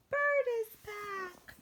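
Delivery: slow, high, self-important bird. Acted. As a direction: announce it grandly in a high bird voice. Bird character announces return.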